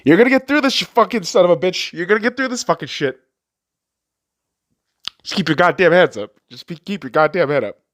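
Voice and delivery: high, loud, tearful encouragement; tearful pep talk. Acted. To his teenage self, loud tearful encouragement. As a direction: urge his younger self on through tears. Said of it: "if I could tell that kid".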